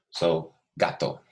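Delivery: "gato" said slowly, Spanish style, GAH-toh; Spanish word. Shown as a demonstration. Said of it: "you have to say gato".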